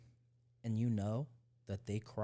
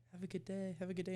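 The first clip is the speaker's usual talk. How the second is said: high, soft mock-polite goodbye; airy mock customer-service voice; imitated flight attendant farewell; 7 semitones higher, 5 dB quieter, faster than usual. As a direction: mimic cabin crew politeness, pitched up and softer.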